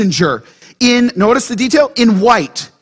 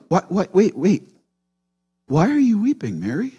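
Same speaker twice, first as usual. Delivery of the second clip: soft, tender questioning; gentle correction; 6 dB quieter than usual. Acted. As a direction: interrupt softly, then gently ask. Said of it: "They're just gently correcting her".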